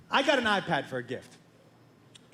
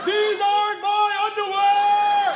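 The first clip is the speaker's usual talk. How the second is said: put-on very high, loud, drawn-out teen wail; imitating an enraged son bellowing slowly; escalated outraged yell; 11 semitones higher, 6 dB louder, slower than usual. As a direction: stretch the protest into a full holler. Son repeats protest, escalating.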